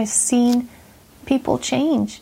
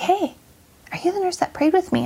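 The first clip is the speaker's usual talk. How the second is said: higher-pitched retold greeting; lightly acted happy recognition; friendly recognition; 7 semitones higher than usual. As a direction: voice a patient recognizing her nurse, pitched up. Patient recognizes and greets her nurse.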